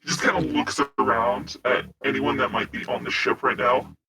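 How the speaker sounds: normal talking voice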